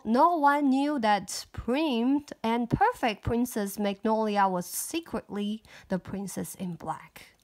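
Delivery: usual speaking voice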